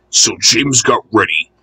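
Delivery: ordinary delivery, nothing marked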